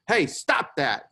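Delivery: exasperated telling-off; frustrated exclamation. Acted. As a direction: exclaim it slowly, fed up with a sibling. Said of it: "me getting frustrated at my siblings".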